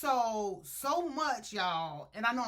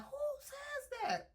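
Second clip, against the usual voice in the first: high, slow cry of disbelief; shocked disbelief; slower than usual. Retold or imitated. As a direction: gasp it out, appalled at her. Shocked at the rude remark.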